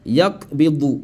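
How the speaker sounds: "yakbidu" with a stopped k; without qalqala. Shown as a demonstration. Teacher shows the rule not applied, without qalqala. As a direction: close the k in "yakbidu", no echo. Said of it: "Je n'ai pas appliqué la règle".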